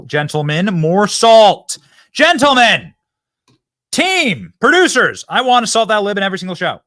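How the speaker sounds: loud, high, fired-up shout to the crew; rallying call. Real, not acted. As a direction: rouse the whole team like a pep rally.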